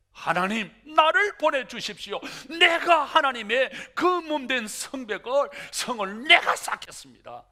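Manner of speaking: loud, high, rousing prayer; eager plea. Acted. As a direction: offer yourself boldly and loudly. Nehemiah volunteering, preached loudly.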